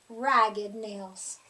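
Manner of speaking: plain reading voice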